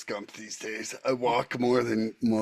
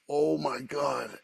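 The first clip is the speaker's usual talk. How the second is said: awed oh my god; 4 semitones higher, much slower than usual. Retold or imitated. Awe at wind sign.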